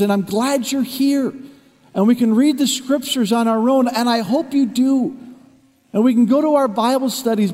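rising, emphatic sermon delivery; preaching. Acted. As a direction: drive the lesson home to the congregation. Preaching the lesson to congregation.

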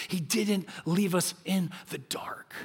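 slow, soft whisper; hushed whisper. Real, not acted. Pastor whispering a truth to his congregation. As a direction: lean in and whisper it slowly.

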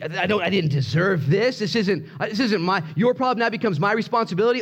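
high-pitched, rising protest; acted freak-out; indignant complaint. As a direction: protest as if wrongly burdened. Acted complaint about others' suffering.

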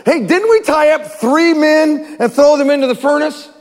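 amazed exclaim. Acted. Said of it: "exclaimed to his advisors".

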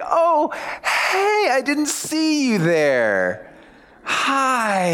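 high, slow, overdone surprise; fake surprise. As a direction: squeal a hammy fake hello. Feigned surprise greeting someone.

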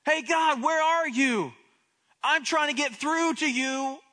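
loud, high, urgent call; David straining to get through to God, loud and high. Acted. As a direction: shout to be heard across a distance. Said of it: "This is David's way of saying".

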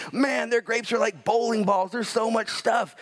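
high-pitched, acted-out amazement; retold excitement, stretching out the end; marvelling spy. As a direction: gush about an astonishing find. Quotes spies marvelling at the land.

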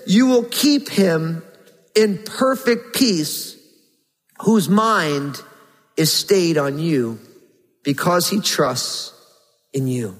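slow, measured verse with weighted pauses; scripture reading. Acted. Preacher reading a verse from Isaiah to the congregation, slow scripture reading. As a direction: read it slowly, phrase by phrase.

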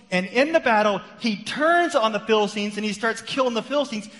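loud, driving sermon delivery, rising high; full-force preaching. Acted. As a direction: preach it out to the whole congregation. Preaching the David and Saul battle.

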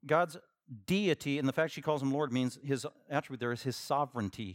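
ordinary talk, nothing marked

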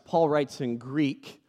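ordinary talk

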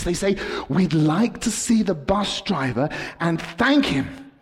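tense story, pitch climbing as the bus speeds; dramatic storytelling. Acted. Dramatic story told to kids.

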